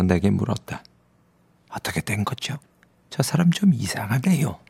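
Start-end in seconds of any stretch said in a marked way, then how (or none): 1.7-4.6 hushed aside about the odd man; astonished whisper. Acted. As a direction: whisper it so he won't hear. Grubman asks narrator about Bartleby.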